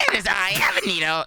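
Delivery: high anime fan voice; weeb taku voice. Acted. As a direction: announce yourself like an eager anime fan. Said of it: "I had to do the voice".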